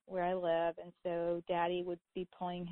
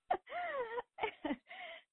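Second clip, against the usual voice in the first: high squealing laugh; 4 dB quieter than usual. Real, not acted. Host laughing at husband's joke, high squealing laugh. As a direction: laugh breaks up high and shrill.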